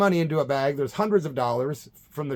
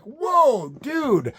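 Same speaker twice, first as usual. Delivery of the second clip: shocked whoa; 10 semitones higher, 3 dB louder than usual. Retold or imitated. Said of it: "And I'm like".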